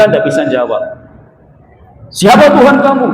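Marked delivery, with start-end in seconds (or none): 2.1-3.1 loud, high, stern interrogation; harsh angel question. Retold or imitated. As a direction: demand it like a fierce angel questioning the dead. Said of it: "Pertanyaan itu keras". (pertanyaan malaikat yang keras dan tinggi)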